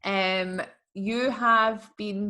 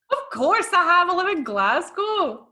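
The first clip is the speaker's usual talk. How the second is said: high, loud incredulous reply; loud "obviously!" with a laugh in it; amused indignation; 10 semitones higher, 6 dB louder than usual. Real, not acted. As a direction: exclaim it as blindingly obvious, half laughing. Indignant answer to an obvious question.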